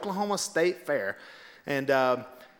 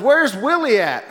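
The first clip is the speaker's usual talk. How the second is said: slow, loud, higher call; 6 semitones higher, 6 dB louder than usual. Retold or imitated. Trump asks the rally for Willie, calling out. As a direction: imitate a speaker calling over a crowd. Trump calls again for him.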